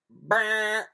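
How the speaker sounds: loud bleat; sheep baa. A narrator's reading. Storyteller bleating as the sheep. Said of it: "said the sheep".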